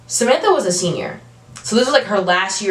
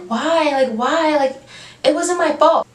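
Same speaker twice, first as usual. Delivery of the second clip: whiny protest; 7 semitones higher than usual. Retold or imitated. Samantha protests, quoted.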